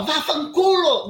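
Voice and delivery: loud, high, drawn-out curse; aggressive shout. Shown as a demonstration. Guest shows host how she didn't curse, loud angry shout. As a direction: yell the swear word full out, stretching it. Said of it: "mas eu não falava".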